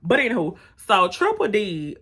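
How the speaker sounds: normal talking voice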